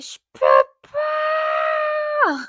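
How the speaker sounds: desperate high scream. Retold or imitated. Mother in labour screams at the nurse, desperate shriek. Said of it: "je hurle".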